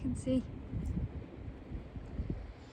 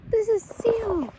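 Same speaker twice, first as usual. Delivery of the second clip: surprised; 9 semitones higher, 4 dB louder, slower than usual. Real, not acted. Gasps at spotting seal.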